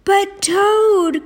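high, protesting cry. A narrator's reading. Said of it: "cried Frog".

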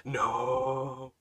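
imitating her creepy drawn-out no; creepy refusal. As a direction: draw out a creepy mock refusal. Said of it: "That's the way she actually talked".

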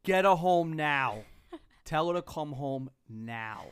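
slow, loud furious command; angry shout. Retold or imitated. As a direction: bark the order, slow and heavy. Dad retelling his angry order.

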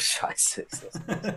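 laughing under breath; breathy laugh through words. Real, not acted. Laughs at own bad pick.

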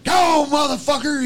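loud, high-pitched yell; retold holler. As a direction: holler to drive a beast away. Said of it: "He's hollering at old Bigfoot".

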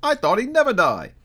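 very high, nasal-free thin voice; third merchant. A narrator's reading. Snuff-taking merchant asking, high voice. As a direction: pipe up with the question.

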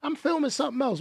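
high, hushed, quick mock refusal; Will Smith. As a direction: mimic a star brushing off the studio, softly. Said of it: "Will Smith was like".

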